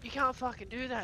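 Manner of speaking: calling out. Retold or imitated. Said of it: "And I'm like".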